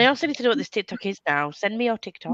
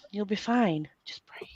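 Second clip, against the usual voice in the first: soothing whisper; 6 dB quieter than usual. Pretend. Calming a stressed friend.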